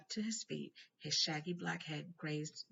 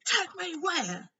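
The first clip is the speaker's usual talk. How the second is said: higher, louder, slow fearful question; slow, high alarmed Hagrid; 14 semitones higher, 10 dB louder, slower than usual. A narrator's reading. Hagrid fearing arrest, higher, louder and slow. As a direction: dread dawning in each word. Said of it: "who was trembling".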